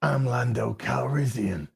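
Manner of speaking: low, soft, smooth introduction; low and quiet, a touch of a charmer; lightly voiced smooth Lando; suave Lando. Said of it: "And he goes".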